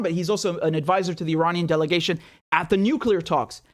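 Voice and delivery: ordinary talk, nothing marked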